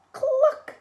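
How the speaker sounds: high, loud hen cluck; a storyteller's clucking hen; chicken cluck. A narrator's reading. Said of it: "said a chicken".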